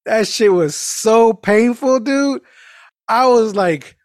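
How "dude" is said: ordinary talk, nothing marked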